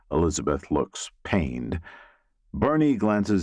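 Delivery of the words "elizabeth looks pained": usual speaking voice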